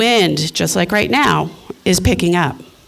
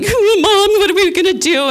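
dogs pleading; 12 semitones higher than usual. Retold or imitated. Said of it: "looking at me like".

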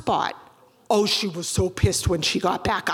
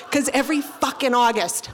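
ordinary delivery

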